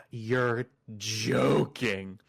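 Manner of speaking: mildly stunned, drawn out very slowly; retold slow-dawning disbelief. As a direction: stretch it out in mock disbelief. Stunned reply in retold scene.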